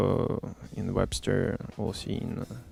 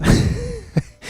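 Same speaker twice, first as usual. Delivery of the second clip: high laugh. Real, not acted. Laughs at his own answer.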